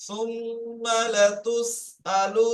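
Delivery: each syllable cut apart, very slow; staccato. Shown as a demonstration. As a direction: chop it word by word, slowly. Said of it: "কেটে কেটে বলি".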